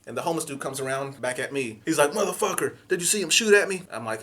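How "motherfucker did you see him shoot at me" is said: high, loud outraged shout. Retold or imitated. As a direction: yell indignantly. Homeless man outraged.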